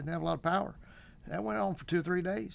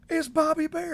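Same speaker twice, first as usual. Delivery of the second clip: much higher and louder gasp of recognition; starstruck excitement; 14 semitones higher, 8 dB louder than usual. Retold or imitated. Fan spotting a country star at the store, excited blurt. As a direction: blurt it out, thrilled to meet a star. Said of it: "and I'm like".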